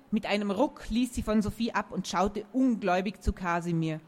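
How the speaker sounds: ordinary delivery, nothing marked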